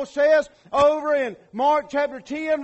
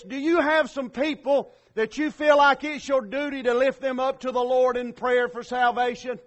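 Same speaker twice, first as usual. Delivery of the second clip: normal talking voice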